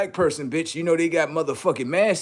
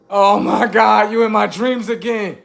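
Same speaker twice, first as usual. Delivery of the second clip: startled; 7 semitones higher, 3 dB louder than usual. Acted. Victim startled by alien visitor.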